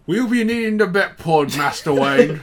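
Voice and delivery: high-pitched Michael Caine-style English butler; hammed-up British film quote; Dark Knight line. Quoting The Dark Knight to co-hosts, hammed-up English butler. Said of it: "here's a line from The Dark Knight".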